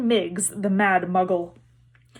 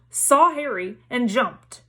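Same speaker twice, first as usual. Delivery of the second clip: ordinary delivery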